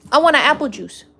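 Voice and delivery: mock-childish demand; insisting. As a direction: put your foot down like a kid. Insists after polite ask.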